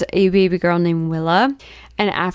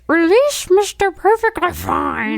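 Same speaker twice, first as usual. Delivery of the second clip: high squeaky goblin voice; 10 semitones higher than usual. Retold or imitated. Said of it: "That's my goblin voice".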